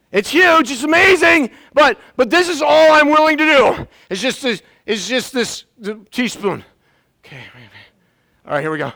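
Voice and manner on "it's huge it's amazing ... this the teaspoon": acted amazement. Preacher acting grudging believer.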